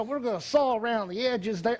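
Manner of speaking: put-on southern accent. Surgeon describes brain surgery to patient, mock southern accent. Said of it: "with that southern accent saying".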